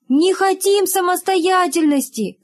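high, drawn-out protest of schoolkids; high-pitched mock uproar; class clamour. A narrator's reading. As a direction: cry out slowly like a rowdy class refusing. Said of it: "загалдел класс".

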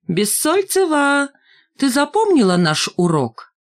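drawn-out high mocking call of her name; high mocking taunt. A narrator's reading. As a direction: taunt her with a clownish sneer. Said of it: "Валька, кривляясь и сгибаясь спиной".

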